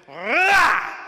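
big, loud, high roar imitating her lunge; imitated monster roar. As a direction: roar it like a possessed woman, full burst. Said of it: "she literally went".

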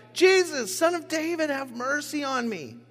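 high, pleading cry for mercy; shouting plea. A narrator's reading. Blind beggar calling out to Jesus, pleading high. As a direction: call out over the crowd, begging. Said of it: "he began shouting".

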